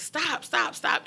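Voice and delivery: high, tearful pleading; crying plea. Retold or imitated. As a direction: beg through tears. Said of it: "I was crying".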